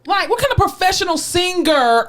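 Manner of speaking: high-pitched, incredulous rebuke; indignant scolding. Real, not acted. Fan scolds the singer who lost his voice, indignant. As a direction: demand it in high, outraged disbelief.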